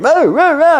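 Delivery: high, loud imitated guard yells; guards shouting. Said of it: "I hear guards shouting".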